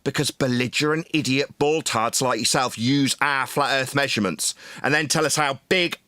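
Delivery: ordinary talk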